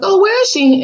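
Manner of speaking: high put-on voice of his parents asking; lightly voiced nosy parents; mock parent voice. Retelling how his parents asked for her.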